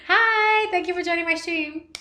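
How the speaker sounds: high, chirpy put-on greeting; mock awkward joiner. Streamer imitating a random box-joiner, high mock greeting. Mimicking people who just stare.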